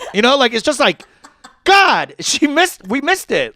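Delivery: God, exasperated and laughing. Real, not acted. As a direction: burst out in disbelief. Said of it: "exasperated outburst".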